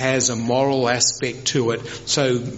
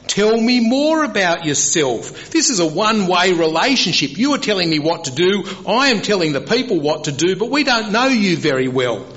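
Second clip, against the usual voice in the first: higher-pitched, insistent plea to God; Moses pleading; 7 semitones higher, 3 dB louder than usual. Retold or imitated. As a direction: voice Moses pressing God for answers. Preacher voices Moses pleading with God.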